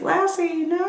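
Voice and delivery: high put-on child voice wailing at the dog; mock crying kid. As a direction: play the kid begging the dog to stay. Mock owner crying at vet.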